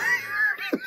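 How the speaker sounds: squeaky giggle; high wheezing laugh. Real, not acted. Reviewer losing it on camera, wheezy laugh. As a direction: let a high giggle escape.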